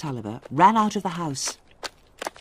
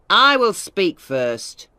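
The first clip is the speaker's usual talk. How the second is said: loud, slow, stressing each word; shouted; 3 semitones higher, 7 dB louder, much slower than usual. A narrator's reading. Tulliver refusing to let Wakem speak, loud slow shout. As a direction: cut the lawyer off with a slow shout. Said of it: "Mister Tulliver shouted".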